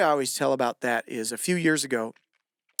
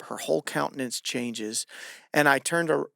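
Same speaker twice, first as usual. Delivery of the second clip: normal talking voice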